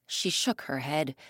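ordinary delivery, nothing marked

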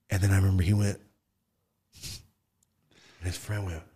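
ordinary delivery

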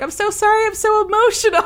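high, tearful mock nurse; a hint of the nurse choking up; teary nurse. Nurse apologizes for crying with new parents, high teary imitation. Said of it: "She's like".

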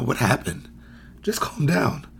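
gentle, hushed concern with a judgy edge; soft but judgy. Shown as a demonstration. Coach softly calming a child, still judgy. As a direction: sound caring yet still dismissive. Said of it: "a little empathetic in my voice, but it's still judgy".

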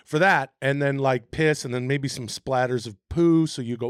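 ordinary delivery

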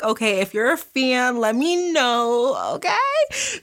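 squealy high okay; excited high. Real, not acted. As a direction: call out to fans, excited. Calling out to fans.

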